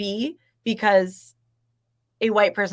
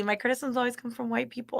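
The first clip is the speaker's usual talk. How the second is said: wry; 8 dB quieter than usual. Real, not acted. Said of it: "The way you just said that was".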